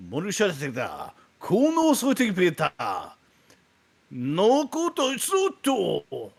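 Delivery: hammed-up fake Japanese lesson; mock Japanese. Teaching listeners fake Japanese for picking up babes. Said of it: "how to speak Japanese".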